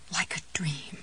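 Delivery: soft, whispered wonder; hushed thought. A narrator's reading. Jessie as all goes to plan, hushed. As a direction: whisper it to yourself. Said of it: "she thought".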